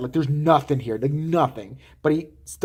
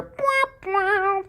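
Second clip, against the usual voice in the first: high-pitched mock "bwah bwah"; imitated comic sound effect. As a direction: mimic a cartoon slapstick noise. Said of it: "all of a sudden you hear".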